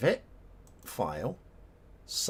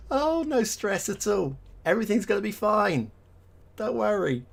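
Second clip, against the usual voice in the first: sarcastic reassurance; 10 semitones higher, 4 dB louder than usual. Pretend. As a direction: pretend to be calm while clearly stressed. Game reload tension, joking.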